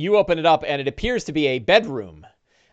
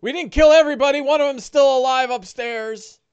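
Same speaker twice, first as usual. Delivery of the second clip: high, fast, louder desperate pleading; desperate orc; 11 semitones higher, 5 dB louder than usual. Acted. Orc blurting out excuses to his captors, high and fast. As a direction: rush it out to save his skin.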